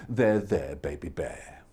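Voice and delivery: low, soft comforting voice; soothing. Acted. Mama Bear comforting Baby Bear, soft soothing. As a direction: soothe the cub gently. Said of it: "And Mama Bear said".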